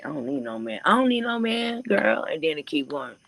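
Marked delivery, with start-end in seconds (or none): 0.8-2.2 much higher and louder, mock sassy girl; sassy girl imitation. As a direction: hype up the "I don't need no man" line like a girl egging her friend on. Girls echoing each other's words.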